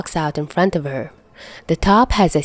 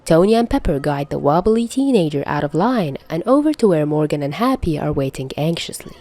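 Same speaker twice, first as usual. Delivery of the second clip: plain reading voice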